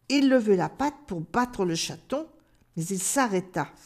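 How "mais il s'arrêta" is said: usual speaking voice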